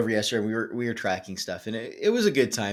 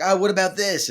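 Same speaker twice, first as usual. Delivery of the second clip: excited idea; 10 semitones higher, 7 dB louder than usual. Retold or imitated.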